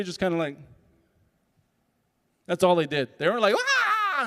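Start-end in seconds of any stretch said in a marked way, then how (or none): 3.6-4.2 high mock shriek of laughter; hysterical laugh imitation. Old ladies in the front row, a high mock laughing shriek. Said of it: "laughing hysterically".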